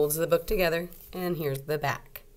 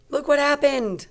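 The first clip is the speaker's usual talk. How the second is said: surprised gasp; 9 semitones higher, 6 dB louder than usual. Real, not acted.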